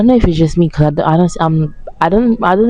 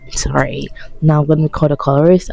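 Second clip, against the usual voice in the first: ordinary talk, nothing marked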